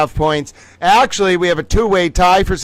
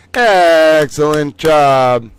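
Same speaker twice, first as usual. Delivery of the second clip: drawn-out praise; 2 semitones lower, 5 dB louder, much slower than usual. Acted. Host announcing quiz results.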